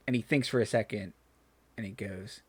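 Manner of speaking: normal talking voice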